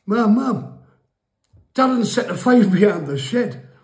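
high, loud tattletale shout; tattling kid. Retold or imitated. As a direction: run in and snitch to mum. Kid telling tales to mum.

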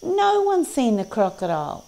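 high storybook creature voice; animals reporting. A narrator's reading. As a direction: start high on "No one's", then fall. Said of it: "said the parrot and the dragonfly".

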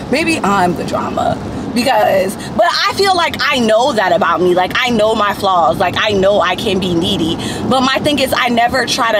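ordinary talk

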